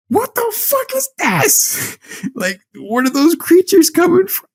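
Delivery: very high comic disbelief, breaking into laughter; incredulous squeal. Retold or imitated. Viewer baffled by little creatures, incredulous squeal. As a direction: squeal in mock bewilderment.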